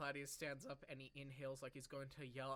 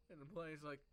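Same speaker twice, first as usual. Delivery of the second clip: ordinary talk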